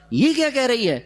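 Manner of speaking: high incredulous scoff. Retold or imitated. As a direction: sound the crowd's disbelief. Crowd scoffing at Maryam. (طنزیہ حیرت)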